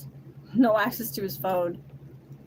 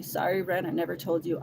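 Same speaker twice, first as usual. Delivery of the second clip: usual speaking voice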